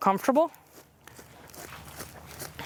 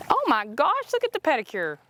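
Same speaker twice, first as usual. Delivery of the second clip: delighted gasp; 6 semitones higher than usual. Real, not acted. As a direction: gasp then high gushing oh my gosh. Admiring horse in water.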